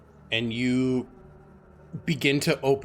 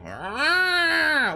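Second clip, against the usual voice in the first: high loud hissing cat; 5 dB louder than usual. Retold or imitated. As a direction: hiss like a guarding cat. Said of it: "possessive cat would fucking just".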